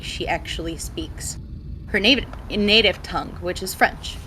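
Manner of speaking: ordinary talk, nothing marked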